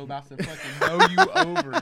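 loud, laughing through the words. Real, not acted. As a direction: crack up while describing. Laughing at fight video.

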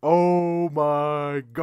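loud, very slow, stretched-out cry; hammy, elongated exclamation; drawn-out catchphrase shout. Acted. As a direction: bellow it with every vowel held long. Group opens with exaggerated catchphrase cry.

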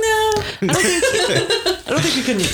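high laughing protest, slowed. Real, not acted. Laughing disbelief.

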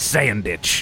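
"sandwich" said as "sayn-ditch"; Jersey-accented "sandwich" with a long "ay"; Jersey pronunciation. Shown as a demonstration. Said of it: "I sometimes have a Jersey accent".

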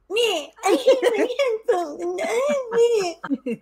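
lightly voiced sulky complaint; mock whiny self. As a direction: friend mocking her own grumpy arrival. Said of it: "I showed up to the call like".

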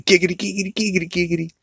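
high, squeaky lecherous sing-song; Quagmire giggity. Retold or imitated. Quagmire running by the party, mock giggity. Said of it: "He goes running by at one point going".

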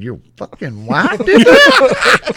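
very high, loud, drawn-out mock disbelief; incredulous. Man hearing who beat up his coworker, high loud disbelief. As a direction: retell it as a stunned, laughing squeal.

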